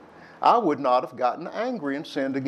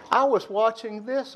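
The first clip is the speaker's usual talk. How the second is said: put-on childish whine; 8 semitones higher than usual. Childhood TV quarrel with sister.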